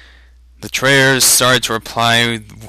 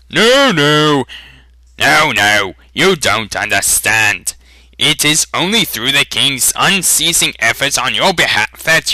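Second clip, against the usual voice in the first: raised, insistent fat official brushing off doubts; pompous pleading traitor; 5 semitones higher, 5 dB louder than usual. Acted. Fat traitor lecturing the villagers, loud and pompous. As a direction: fuss and protest, talking down to the crowd.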